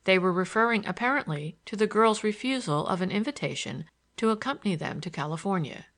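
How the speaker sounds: ordinary delivery, nothing marked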